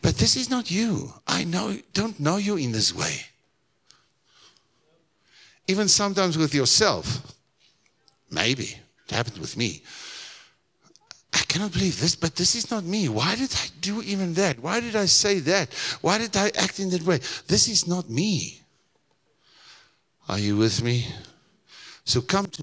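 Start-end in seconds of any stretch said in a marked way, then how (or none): 0.0-3.3 high, pleading reproach to someone close; disbelieving friend. Acted. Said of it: "and you tell the guy". 11.3-18.6 high-pitched disbelief at oneself; dismayed self-reproach. Acted. Acts out regretful self-questioning.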